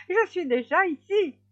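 high, loud taunting call; wife calling out. A narrator's reading. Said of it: "la femme du hérisson lui cria". (cri aigu de la femme du hérisson)

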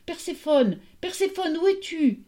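high, searching call; high-pitched cry into the night. A narrator's reading. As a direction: call out for a lost daughter.

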